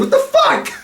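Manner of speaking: squeaky high disbelief; shocked. Retold or imitated. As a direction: jump way up in pitch, stunned. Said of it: "He's like".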